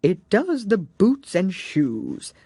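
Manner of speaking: slow, grave Gryphon voice; solemn Gryphon. A narrator's reading. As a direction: say it solemnly like a pronouncement. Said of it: "replied very solemnly".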